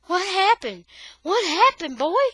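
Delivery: high, loud frantic call. Acted. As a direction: call through the door in fear. Boy calls through the door.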